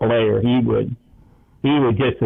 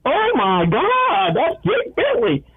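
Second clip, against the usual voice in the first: slow, much higher astonished realization; retold amazement; 12 semitones higher, slower than usual. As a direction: act out the sudden penny drop. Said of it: "he goes".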